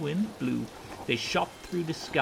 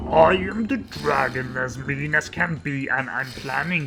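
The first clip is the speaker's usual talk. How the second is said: menacing dragon voice, a touch louder; scary dragon; 5 dB louder than usual. Acted. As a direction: growl it like a hungry dragon. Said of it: "a roar that was scary and loud".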